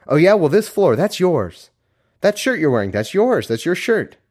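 high, insistent pointing-out voice; pointless visitor. Acted. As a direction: keep helpfully stating the obvious. Visitor keeps pointing things out.

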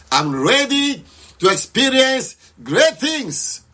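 slow, high, drawn-out prayer cry; passionate declaration. Shown as a demonstration. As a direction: declare it with passion, stretching the words. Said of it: "Just shout aloud".

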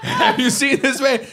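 high, exaggerated alarm on a wanted notice; mock public warning. Warning everyone about the man on the signs, mock public warning. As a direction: sound a silly high-pitched warning.

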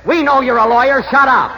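loud, high snapping order; angry shout. Acted. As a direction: shout the lawyer down. Shouts the lawyer down.

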